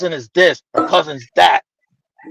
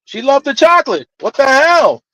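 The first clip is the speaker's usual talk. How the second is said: indignant outburst; 7 semitones higher than usual. Retold or imitated. Uncle's stunned outburst.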